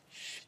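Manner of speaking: very quiet "shh"; soft shush. Acted. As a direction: hush them softly. 하나님의 조용하라는 신호 흉내. (나직한 쉿 소리)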